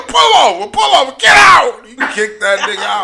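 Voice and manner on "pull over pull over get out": higher, louder barked order; angry shout. Retold or imitated. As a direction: throw him out of the car. Ordering driver to pull over.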